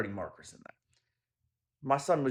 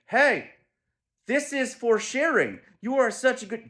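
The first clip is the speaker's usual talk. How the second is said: pointed praise; 11 semitones higher, 5 dB louder than usual. Pretend. Pointedly praising kids for sharing.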